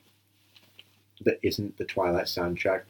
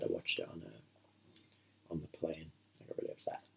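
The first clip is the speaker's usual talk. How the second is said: hushed whisper; 3 semitones lower, 16 dB quieter, slower than usual. Real, not acted. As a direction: whisper so the film plays on. Quiet aside during film.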